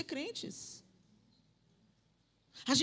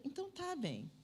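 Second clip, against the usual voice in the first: normal talking voice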